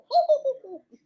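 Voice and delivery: loud laugh at himself; laughing hard; burst of laughter. Real, not acted. Laughs at own witch sound.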